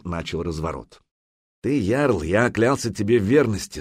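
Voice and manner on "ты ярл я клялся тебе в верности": ordinary delivery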